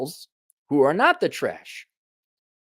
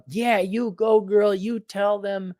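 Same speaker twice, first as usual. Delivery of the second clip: mock cheerleader; 7 semitones higher, slower than usual. Mocking women cheering each other on.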